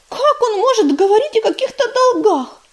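high-pitched indignant outburst. A narrator's reading. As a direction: demand it in outraged disbelief. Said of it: "возмутилась Листвичка". (возмущённо, высоким голосом)

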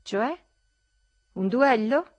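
high, loud rising queries; incredulous question. A narrator's reading. Ferfitkin answering a challenge to a duel, incredulous.

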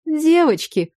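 «Девочки» pitched high as a plural correction; correcting. A narrator's reading. Doctor correcting the mother-to-be, high emphatic «Девочки». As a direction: lift «Девочки» brightly to correct her. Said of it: "поправила меня Ирина".

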